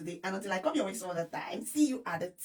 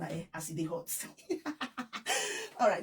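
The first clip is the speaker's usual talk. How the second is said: ordinary talk, nothing marked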